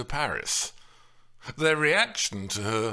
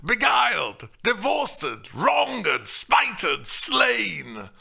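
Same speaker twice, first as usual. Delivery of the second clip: stylized exclamations of Paris; 9 semitones higher, 5 dB louder, much slower than usual. Acted. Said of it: "highly stylized exclamations of Paris".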